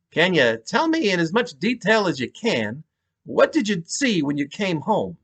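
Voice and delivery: high-pitched New York detective; patient New York cop; New York accent. Acted. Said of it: "thick New York accent".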